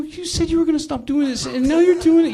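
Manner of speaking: high, pleading voice as the wronged husband; hurt husband begging his wife, voice way up; emotional plea. Retold or imitated. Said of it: "I really started getting emotional".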